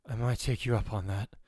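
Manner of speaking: plain reading voice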